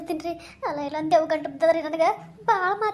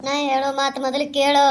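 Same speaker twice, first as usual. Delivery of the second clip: a lightly voiced menacing ghost; 6 semitones lower, 4 dB louder than usual. Ghost orders.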